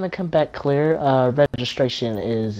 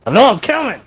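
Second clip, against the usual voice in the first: shouting back; 9 semitones higher, 9 dB louder than usual. Real, not acted. Called over, shouting back.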